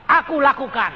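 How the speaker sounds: loud, high booming declaration; God's retold decree. God answers after Papa's anger, loud acted decree. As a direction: proclaim it as God's verdict, acted.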